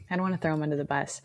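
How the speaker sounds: hushed and hurried; whispered aside. Real, not acted. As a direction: drop to a whisper so he can't hear. Careful aside about partner.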